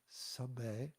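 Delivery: "sabe" whispered, A as in "say". Shown as a demonstration. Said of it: "the next is also the same A sound".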